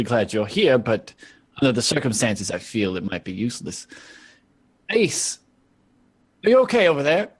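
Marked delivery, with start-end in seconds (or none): 4.9-7.3 higher, louder call across the room. Acted. Molly calling to Ace across the room, raised. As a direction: call over with mock concern.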